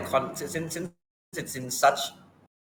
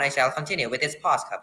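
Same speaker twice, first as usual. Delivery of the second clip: ordinary talk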